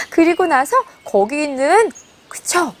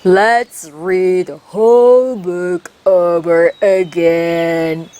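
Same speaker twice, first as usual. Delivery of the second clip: much lower, very slow tortoise voice; a calm old tortoise invites another read; tortoise smiling; 11 semitones lower, much slower than usual. Acted. 거북이가 토끼에게 미소 지으며 하는 낮고 느린 말. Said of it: "smiles and says".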